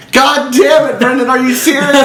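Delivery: lightly voiced annoyed father; mock exasperated dad. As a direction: play the dad at the end of his rope.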